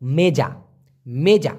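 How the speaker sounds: মেজা said twice, clear and firm; clear repetition. Shown as a demonstration. Teacher drilling the Malay word for the class, firm. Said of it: "টেবিলকে Malaysian ভাষায় কি বলে?".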